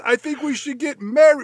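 high, loud mock proposal. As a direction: blurt it like an eager suitor. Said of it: "what I was gonna say was".

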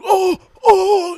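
high, loud yelping oh; hammed-up pained cries; mock pained Cage. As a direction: yelp like he's hurt. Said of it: "he's like".

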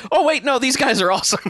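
quick, high-pitched delighted turnaround; suddenly won over, voice jumping up high; mock excited realization. Podcaster voices a viewer won over by the arcade, excited.

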